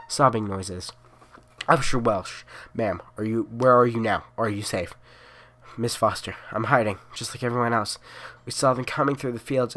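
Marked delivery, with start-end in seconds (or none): none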